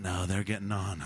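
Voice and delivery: low, soft murmur as the wife; imitated wife. Wife backstage as the children reach the stage, low and quiet. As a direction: drop low and quiet, voicing her aside. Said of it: "And Storm's going".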